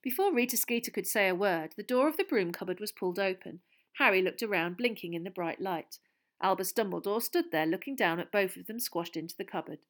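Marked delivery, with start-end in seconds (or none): none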